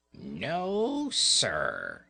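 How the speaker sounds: a light kitten growl, lightly voiced; growled refusal. Boots refusing the dolls, lightly growled. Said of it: "Boots growled".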